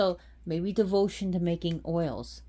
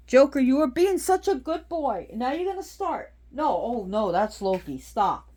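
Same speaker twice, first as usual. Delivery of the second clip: high, loud pet scolding; scolding the dog; 7 semitones higher, 7 dB louder than usual. Real, not acted. Owner scolding her dog for misbehaving, loud and high. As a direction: tell the dog off sharply. Scolding pets during video.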